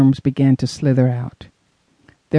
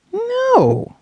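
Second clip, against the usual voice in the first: appalled. Acted. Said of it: "she called back, appalled at the thought".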